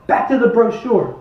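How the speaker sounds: ordinary talk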